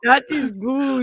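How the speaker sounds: slow, muddled Arnold impression; half-slipping into Arnold; Arnold voice attempt. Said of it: "I like how you confuse yourself".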